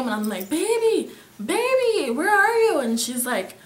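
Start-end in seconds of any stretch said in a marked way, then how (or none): 0.5-2.8 high, loud retold call; screaming her name. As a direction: cry out as if searching for someone lost. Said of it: "I'm screaming her name".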